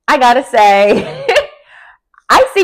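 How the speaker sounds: real laughter breaking into the verdict; laughing. Real, not acted. Vlogger laughs while siding with Portia on the fight.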